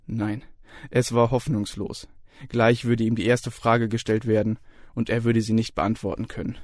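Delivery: ordinary delivery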